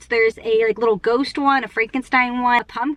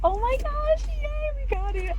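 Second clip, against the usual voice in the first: high delighted squeal; shrieking jackpot winner; 6 dB quieter than usual. Real, not acted. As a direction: squeal with joy over the prize. Gets the pumpkin bucket.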